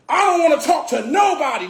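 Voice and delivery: imitating a furious club owner's shout; angry yelling. Retelling the club owner yelling at his guests, mock furious shout. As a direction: yell it high like a belligerent owner. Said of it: "you came out wilding".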